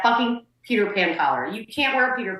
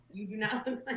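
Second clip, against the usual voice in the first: laughing through words; 12 dB quieter than usual. Real, not acted.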